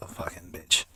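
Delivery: unvoiced whispered swear under the breath; bitter whispered aside; whispered curse. Real, not acted. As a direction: hiss it under your breath. Muttered insult at nurse.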